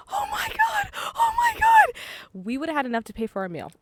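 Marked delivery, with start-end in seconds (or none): 0.1-1.9 high squealing repeated exclamation; panicked oh my god. Retold or imitated. Caught in pouring rain, high squeal. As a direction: shriek it in rising panic. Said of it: "every time I said".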